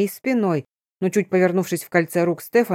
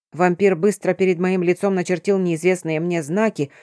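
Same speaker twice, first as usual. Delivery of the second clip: ordinary delivery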